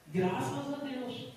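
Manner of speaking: slow thankful. Real, not acted. Preacher thanking God after son's news, slow and soft. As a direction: breathe out the thanks slowly.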